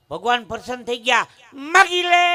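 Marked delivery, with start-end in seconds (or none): 1.5-2.2 high, loud call from a god; booming divine offer. Acted. God granting a devotee a boon, booming.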